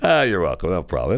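usual speaking voice

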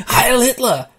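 mock Nazi salute. Mock Nazi salute after camp joke.

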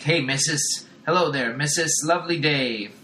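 lightly voiced chirpy street man; a hint of a jolly street dweller; cheery street fellow. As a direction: sing out a friendly hello to a passerby. Street dude greets Sarah.

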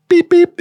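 high, loud beep beep like a car horn; high, loud imitated car horn; mock horn beeps. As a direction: toot it like a polite little honk. Imitating a light honk.

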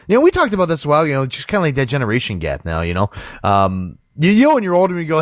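ordinary delivery

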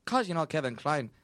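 hint of a stuttering Irish accent; lightly faked Irish accent; attempted Irish accent. Shown as a demonstration. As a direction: try an Irish brogue and wobble. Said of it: "I can do an Irish accent".

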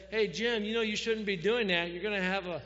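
calling over to a neighbor; mock yell. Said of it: "he sort of yelled over".

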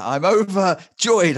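high, loud, slow theatrical overacting; hammy stage actor. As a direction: declaim it like a ham on stage. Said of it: "I'm overacting".

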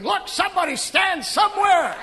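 exasperated pastor shouting at bickering families. Retold or imitated. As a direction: yell at them to just pick a spot. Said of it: "I'm standing there going".

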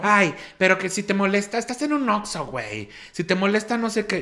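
high, whiny, snooty retort; mocking woman's voice. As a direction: sneer it like an offended customer. (imitación chillona y altanera)

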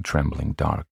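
ordinary delivery

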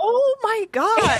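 high, slow, stretched-out dismay at dad; mock horrified reaction. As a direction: act appalled, drawing it out high. Acting her reaction to dad.

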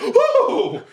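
high squeaky startled yelp; a small badger jumping in fright; high-pitched badger yelp of surprise.

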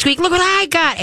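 very high, singsong squeak squeak and look what I got; high coaxing dog call. Shown as a demonstration. Trainer luring a loose dog home with a toy, very high coaxing call. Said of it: "squeak, squeak".

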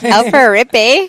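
put-on Canadian accent. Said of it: "Stop talking Canadian".

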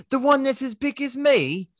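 put-on Dickensian street kid answering; cockney boy. Cohost voices the boy in high squeaky cockney. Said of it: "Christmas Carol".

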